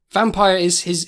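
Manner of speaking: ordinary talk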